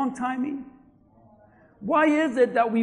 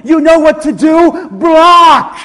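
full-force preacher's shout, pitched up; commanding shout; 4 semitones higher, 15 dB louder, slower than usual. Real, not acted. Preacher telling the congregation what to do, commanding shout. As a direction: shout it as an order to the crowd.

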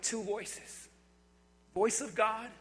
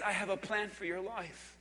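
low, hushed reassurance; voice of God; 5 semitones lower, 11 dB quieter than usual. Acted. Preacher voices God promising a plan, soft and reassuring. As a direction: promise it softly, pitched down. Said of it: "The voice of God says".

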